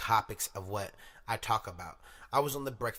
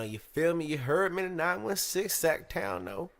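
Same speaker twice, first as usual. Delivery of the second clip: higher, louder hometown shout-out; hype shout-out; 8 semitones higher, 6 dB louder than usual. Real, not acted. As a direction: rep the city loud.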